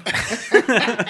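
high burst of laughter; loud laugh. Real, not acted. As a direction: crack up loudly. Big laugh at friend.

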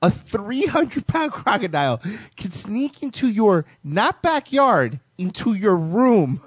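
high, climbing disbelief; amazed and increasingly high; incredulous. Real, not acted. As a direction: tell it in rising, high-pitched disbelief. Incredulous crocodile story.

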